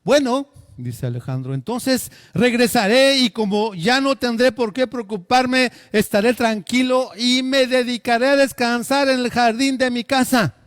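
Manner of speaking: loud, high, declaiming voice; grand proclamation. Acted. Alexander boasting his plans to the philosopher, loud and high. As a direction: announce it like a victory speech.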